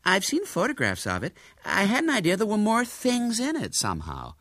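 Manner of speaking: high, puzzled American lady. A narrator's reading. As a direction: wonder aloud in mild confusion. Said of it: "Miss Burford looked rather puzzled".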